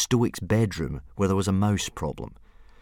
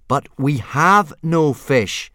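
slow, raised, louder insistence; firm but pleasant; 6 semitones higher, 8 dB louder, much slower than usual. A narrator's reading. Boy tries to dominate the dragon, slow and firm. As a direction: spell it out slowly and firmly. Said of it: "make his voice sound firm but pleasant".

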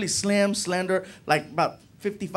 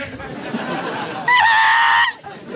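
loud high laugh; 4 dB louder than usual. Real, not acted. Big laugh at own joke.

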